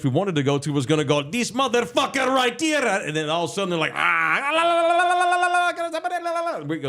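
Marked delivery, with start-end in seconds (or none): 1.3-2.8 put-on Indian accent. Real-place manager accusing his rival, imitated Indian accent. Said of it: "in that Indian accent". 3.9-6.6 very high, rattling la-la-la jabber; imitated angry gibberish. Host imitating two managers suddenly arguing, high rattled gibberish.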